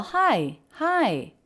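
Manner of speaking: "Hi, hi" with the pitch going up then down. Shown as a demonstration. Teacher showing students the friendly greeting with an up-down "hi". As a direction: let "hi" rise high and fall away. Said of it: "has sort of an up-down shape".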